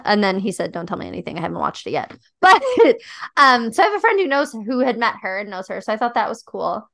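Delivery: ordinary delivery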